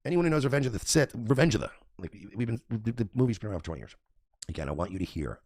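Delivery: normal talking voice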